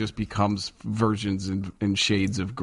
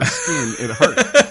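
laughing; 8 dB louder, much slower than usual. Real, not acted. Laughing recalling snow falls.

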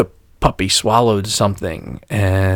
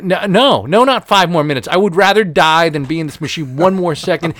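panicked pleading; 7 semitones higher than usual. Retold or imitated. Panicked in MRI, begging out.

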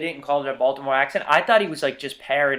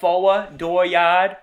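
higher, louder Boston R-dropping; Kennedy-style Boston accent; 4 semitones higher, 6 dB louder, slower than usual. Shown as a demonstration. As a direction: demonstrate old Boston R-less vowels. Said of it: "in that Kennedy accent".

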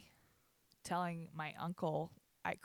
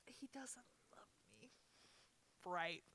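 real crying, barely whispered; tearful whisper; 6 dB quieter than usual. Real, not acted. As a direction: whisper it through tears. Crying over grandpa's love.

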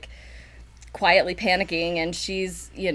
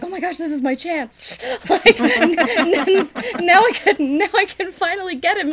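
squealing, much higher and louder, breaking into laughs; giddy girl; 10 semitones higher, 5 dB louder than usual. Retold or imitated. Wife seizing her chance with the drunk husband, giddy squeal. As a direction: gush like a scheming, thrilled girl. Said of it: "she's like".

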